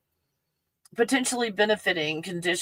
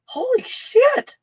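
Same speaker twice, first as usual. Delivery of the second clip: much higher, slow, amazed curse; stunned exclamation; 5 dB louder, slower than usual. Real, not acted. Reacting to the mint's rush, stunned and amazed. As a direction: let the shock stretch out high.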